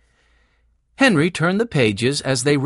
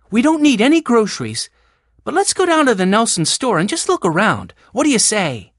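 put-on high, louder girl character; eager Jessie; 8 semitones higher, 4 dB louder than usual. A narrator's reading. Jessie proposes a trip to the store, eagerly. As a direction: propose a plan in a high, lively voice.

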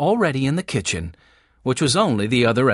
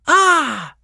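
high, loud, hammed-up "aha"; trying to be funny; 13 semitones higher, 5 dB louder than usual. A narrator's reading. Benny clowns about a puzzle, with mock drama. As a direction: play it up like a boy joking. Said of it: "trying to be funny".

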